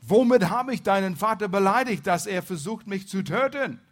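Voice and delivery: loud, high-pitched demand for an answer; urgent, aggrieved outcry; crying out. A narrator's reading. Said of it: "rief er".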